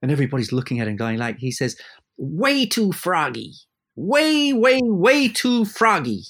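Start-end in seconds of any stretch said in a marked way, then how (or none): 2.2-6.2 high, slow, drawn-out camp complaint; camp theatrical conductor. Retold or imitated. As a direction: ham up the fussy maestro. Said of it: "a very theatrical voice, let's say, kind of camp".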